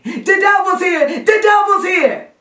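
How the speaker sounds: high, loud shriek of alarm; frantic high-pitched shouting; terrified child's scream. Acted. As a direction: scream the warning in panic, drawing it out. Terrified boy raising the alarm.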